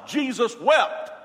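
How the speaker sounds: loud, high and slow "Jesus wept"; fervent climax; emphatic. Acted. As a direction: land "Jesus wept" slowly at the top of the voice. Climactic favorite verse.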